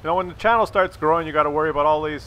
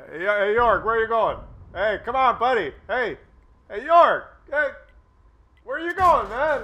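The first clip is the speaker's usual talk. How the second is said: calling after him; 5 semitones higher than usual. Acted. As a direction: call him back as he walks away. Calling after partner walking off.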